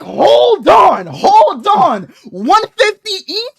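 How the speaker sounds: loud, high outburst of disbelief; shocked disbelief. Retold or imitated. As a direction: shout it like you just saw the bill. Shocked at the bill.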